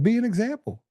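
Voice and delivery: retold defiant dare. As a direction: throw it back as a high-pitched challenge. Retells defiant retort to a threat.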